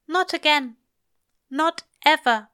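firm refusal. Acted. Angel refusing Crowley forever, high and pressed. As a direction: shut it down for good.

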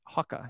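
"Hoka" said as HAW-kah; quiet "Hoka" with a "hawk" vowel; spelt-out British Hoka. Shown as a demonstration. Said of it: "like H A W K A H".

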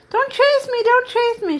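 high, small cry of alarm; scared china princess squeal; frightened little voice. A narrator's reading. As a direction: plead in a tiny, very high voice. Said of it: "She had such a frightened little voice".

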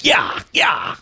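high, loud "yeah yeah" holler; cowboy yell. Acted. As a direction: holler it like whipping a horse. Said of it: "Whenever I say Spurs".

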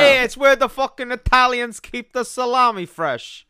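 loud, hammed-up Italian-American voice; mock Italian accent. Teasing a friend about the bag, hammed-up Italian accent. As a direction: ham up an Italian wise guy, loud. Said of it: "do the Italian fingers when you talk like this".

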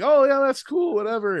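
high, breezy brush-off; mock unimpressed. The narrator brushing off Jamie's pointing, in a high mock-casual voice. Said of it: "And I'm like".

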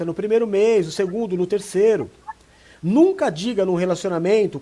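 ordinary talk

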